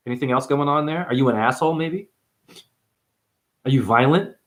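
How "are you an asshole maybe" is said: usual speaking voice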